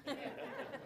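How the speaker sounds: a quick amused laugh at his own joke; short laugh. Real, not acted. Preacher laughing at his own seven eleven joke, a quick laugh. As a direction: let a brief chuckle slip.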